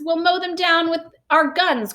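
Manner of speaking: eager cry. A narrator's reading. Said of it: "cried the head of the army".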